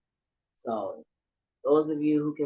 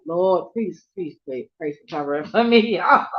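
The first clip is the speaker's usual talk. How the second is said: loud, mock-desperate plea to God; pleading prayer; 8 dB louder than usual. Asking God for covering, loud pleading. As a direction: beg the Lord out loud.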